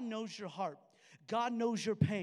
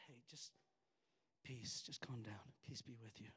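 calming whisper; 10 semitones lower, 14 dB quieter than usual. Retold or imitated. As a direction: whisper peace to calm them. Risen Jesus calming his disciples.

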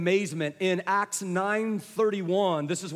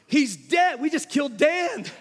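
high mock-horrified exclamation; retold shocked cry; 4 semitones higher, 3 dB louder than usual. Crowd after the pastor's fall, mock-horrified shout. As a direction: cry out like an alarmed onlooker, hammed up. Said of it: "everybody kind of gasped and was like".

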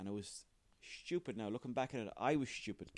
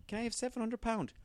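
high put-on child asking sweetly; kid begging; 12 semitones higher, 3 dB louder than usual. Kid asking dad for seven hundred pound, high childlike plea. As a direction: ask like a little kid after money.

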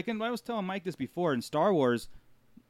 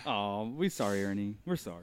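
lower, softer, fading mock pity; mock consolation; 8 semitones lower, 7 dB quieter than usual. Consoling Ernie after his ride story, soft fading mock pity. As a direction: coo sympathy with a wink.

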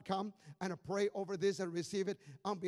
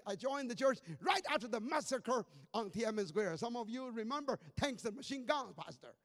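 ordinary delivery

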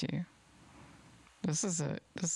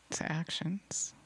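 slow hushed whisper; slower than usual. Real, not acted. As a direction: drop to a breathy whisper to drive it home. Emphatic point in sermon.

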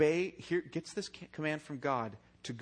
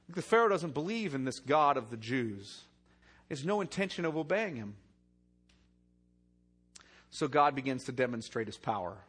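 usual speaking voice

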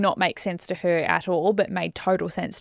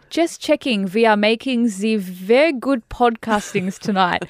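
hammed-up French-German accent, higher pitched; mock French-German accent; 3 semitones higher, 4 dB louder than usual. Reading a friend's message to the co-host, mock French-German accent. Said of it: "French flag, German flag".

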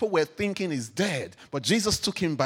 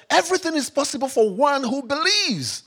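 emphatic declaration; 8 semitones higher, 6 dB louder than usual. Acted. Jesus's words, preached emphatically.

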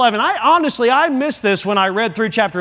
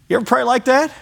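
ordinary delivery

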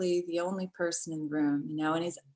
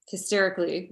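usual speaking voice

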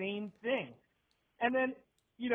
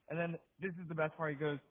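normal talking voice

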